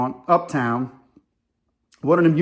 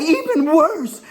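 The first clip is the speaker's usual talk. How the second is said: high-pitched mock outrage; imitated disgusted woman; 13 semitones higher, 4 dB louder, much slower than usual. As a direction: proclaim it high, mimicking her disgust. Acts woman's scornful comeback.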